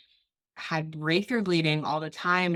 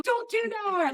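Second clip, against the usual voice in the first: high, squeaky mock plea; mock pleading no; slower than usual. Acting out her plea to the doctor, high and squeaky. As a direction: beg them not to, in a pinched high voice. Acted quote telling doctor no.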